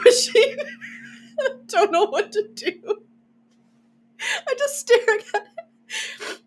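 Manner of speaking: high, teary laugh breaking the words; laughing till you cry. Real, not acted. Host retelling her airport mishap, crying with laughter.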